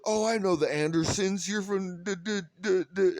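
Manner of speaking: high, bubbly gushing voice, lightly mocked; mock her chirpy know-it-all tone; a hint of a gushing local woman. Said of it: "She said".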